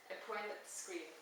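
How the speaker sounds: ordinary delivery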